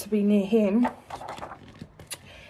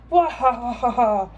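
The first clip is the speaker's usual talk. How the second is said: villain laugh. Acted. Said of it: "laughed Alan".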